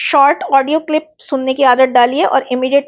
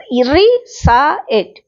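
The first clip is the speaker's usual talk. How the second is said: Recite broken very slowly into री, सा, इट; syllable by syllable; 2 semitones higher, much slower than usual. Shown as a demonstration. Teacher showing students what a syllable is, recite split into slow syllables. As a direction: say recite in separate drawn-out pieces, री - सा - इट. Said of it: "ये syllable कहलाता है".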